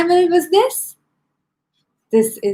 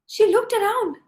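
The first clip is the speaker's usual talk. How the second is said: usual speaking voice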